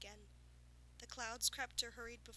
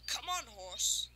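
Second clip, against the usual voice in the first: coaxing farmer; 7 dB louder, slower than usual. Acted. Farmer coaxing the unicorn toward his belt, slow. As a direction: lure her in like a stubborn horse.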